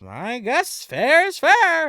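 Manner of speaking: high, slow goblin voice; squeaky-toned goblin, drawn out. Acted.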